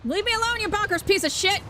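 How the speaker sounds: high-pitched shout at an attacker; frantic angry yell. Real, not acted. Player chased by an enemy in game, frantic angry yell. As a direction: yell them off in exasperation.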